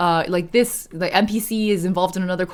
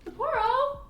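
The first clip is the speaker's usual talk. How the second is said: very high, soft sing-out; calling a pet; 5 dB quieter, slower than usual. Real, not acted. Streamer calls her cat, very high soft call. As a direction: coax the cat in a tiny high voice.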